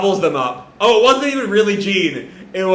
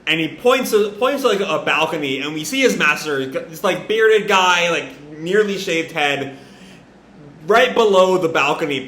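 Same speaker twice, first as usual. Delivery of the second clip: ordinary delivery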